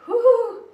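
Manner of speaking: high, loud whoop of breath; nervous whew. Real, not acted. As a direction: blow off nerves with a sharp whew. Steeling nerves with trapped bee.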